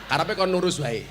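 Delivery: normal talking voice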